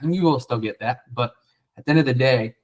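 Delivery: ordinary talk, nothing marked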